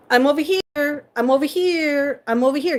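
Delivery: lightly voiced crow calling to its tribe. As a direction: call out to the flock like a crow. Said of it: "the crows actually play Marco Polo".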